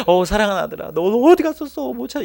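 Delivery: high, gushing mock-loving mother; hammed-up American mother. American mom greeting her lost beloved son, hammed-up affection. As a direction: overdo a relieved movie mother, pitch soaring. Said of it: "미국 엄마였으면 이렇게 말했을 것 같습니다".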